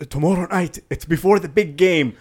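lightly imitated Egyptian accent coach; a hint of an Egyptian accent, preaching to players. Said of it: "thick Egyptian accent when he speaks".

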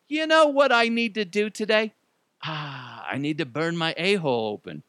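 high, eager voice of a dumb plan; a mock-excited guy with a bad idea; mock Canadian man. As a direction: play the eager fool announcing his plan.

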